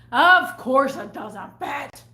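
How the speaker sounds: loud, slow, drawn-out sarcasm; retold scornful retort; sarcastic exasperation. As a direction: stretch it out, fed up and loud. Sarcastic reply to customer.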